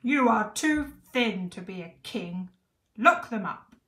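loud, imperious command; yelling king. A narrator's reading. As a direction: yell it as a scornful royal order. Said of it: "yelled the fat king".

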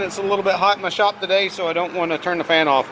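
ordinary delivery

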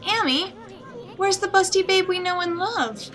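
high, excited small-companion voice; shocked little sidekick. Acted. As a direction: squeak it out like a tiny hero in shock.

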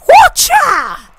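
high, loud yelp done for the viewers; repeated yelp. Retold or imitated. Gamer repeating his cheer for viewers, high loud yelp. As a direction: replay the cheer for the fans. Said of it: "On a bit of like".

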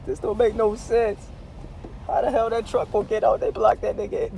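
usual speaking voice

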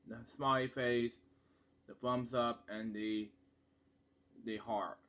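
hands over mouth; sniffing chocolate while talking; chocolate held to mouth. Real, not acted. Seen 0: Chocolate and hands cover mouth most of the time; briefly shown to camera early.

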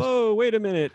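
a flustered dad caught off guard in a checkout line; jolted, high-pitched alarm; startled whoa. Retold or imitated. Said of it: "Anthony's like".

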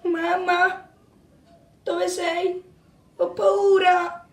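small, high, soft plea; frightened child. Acted. Lost child calls for mamma, frightened and soft. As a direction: softly whimper it, drawing out the end.

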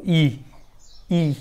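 ई, ई as isolated "i" sounds; short I. Shown as a demonstration. Teacher answering own question for students, ई repeated separately. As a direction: say each ई alone, pausing between. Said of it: "आयचा उच्चार कसा होतो".

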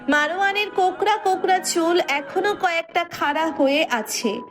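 usual speaking voice

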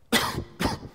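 a real dry cough from a cold. Real, not acted. Pastor coughing mid-sermon from his cold. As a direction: cough once, sharply. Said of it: "A bit of a cold".

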